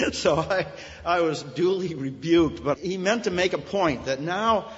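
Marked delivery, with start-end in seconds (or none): none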